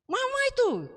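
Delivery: high-pitched mock yell, little extra volume; retold child's yell. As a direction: mimic a child crying out in complaint. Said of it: "dia tuh teriak".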